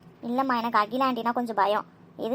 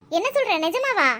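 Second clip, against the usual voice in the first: high-pitched surprised question; amazed disbelief; 8 semitones higher, 3 dB louder than usual. Acted. Sakthi hearing friends are invited, amazed high question. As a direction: react as if it's too good to be true. Disbelieving happy reaction to news.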